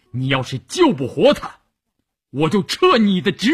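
stern threat. A narrator's reading. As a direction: threaten a subordinate, climbing to a high, loud finish. Commander threatens subordinate by phone. (严厉威胁，越说越高)

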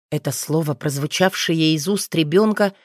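usual speaking voice

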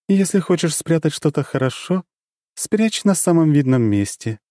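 high, sly goddess voice. A narrator's reading. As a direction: play the dark goddess, knowing.

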